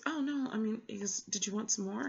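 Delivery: imitating the flustered librarian. Librarian backpedaling quoted.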